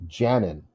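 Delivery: "Janin" as JAN-in; short stressed vowel up front in "Janin", weak ending; stress on first syllable. Shown as a demonstration. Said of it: "Is the first syllable stressed?".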